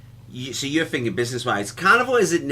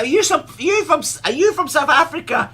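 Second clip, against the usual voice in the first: high and loud, laughing through it; high laughing quote; 14 semitones higher, 6 dB louder than usual. Retold or imitated. Asking the son-in-law where he's from, high and laughing. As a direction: pitch it way up, giggling. Retold chat with Irish guest.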